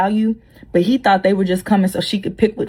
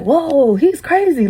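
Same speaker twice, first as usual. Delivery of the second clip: shocked; 7 semitones higher, much slower than usual. Retold or imitated. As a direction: react in disbelief.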